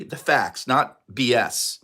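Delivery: usual speaking voice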